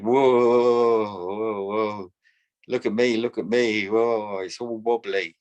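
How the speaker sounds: lightly voiced little girl squealing whoa; a child delighted on a wobbly toy, mildly voiced; excited child. Granddaughter on wobbly spring toy.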